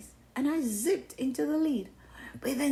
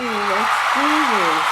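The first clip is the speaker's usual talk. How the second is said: excited shout; 13 dB louder than usual. Real, not acted. Hyped story intro.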